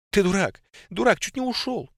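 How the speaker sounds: high, loud tearful scolding; crying and laughing. A narrator's reading. Nika scolds the revived Phil, high tearful relieved scolding. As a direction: scold him through relieved tears. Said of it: "плакала и смеялась одновременно".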